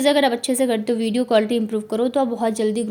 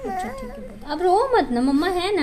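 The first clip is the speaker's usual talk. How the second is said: higher, hushed, tender reassurance; tender soothing; 8 semitones higher than usual. Real, not acted. Mother to her sobbing daughter, tenderly reassuring. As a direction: hold her close with your voice.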